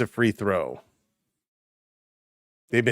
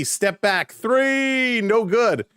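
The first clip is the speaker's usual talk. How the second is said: excited call; 7 semitones higher, much slower than usual. Real, not acted. Live shot call.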